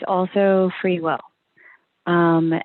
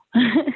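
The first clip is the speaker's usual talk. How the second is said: light laugh; 6 semitones higher than usual. Real, not acted. Guest laughs at the invite.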